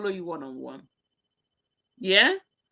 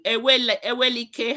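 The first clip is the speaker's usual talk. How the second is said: ordinary talk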